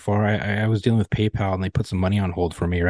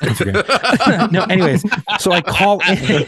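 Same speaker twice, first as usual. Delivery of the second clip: talking through real laughter, higher and louder; laughing; 8 semitones higher, 6 dB louder, slower than usual. Real, not acted. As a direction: keep going while cracking up. Cracking up at the gecko plug.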